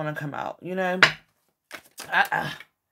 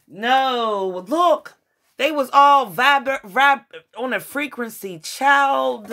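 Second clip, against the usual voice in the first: excited disbelief; 6 semitones higher, 4 dB louder, slower than usual. Real, not acted. Reacting to card reading.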